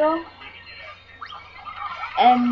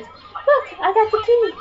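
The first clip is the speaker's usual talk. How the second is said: high-pitched excited reveal; cartoon excitement, pitched way up; Patrick; 11 semitones higher, 3 dB louder than usual. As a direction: squeak it out proudly. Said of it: "Patrick has something behind his back and says".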